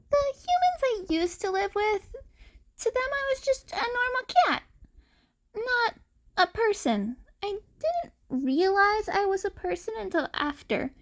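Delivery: put-on high, small boyish voice; young boy telling his past. A narrator's reading. The cat boy explains his past to the teachers, high and small.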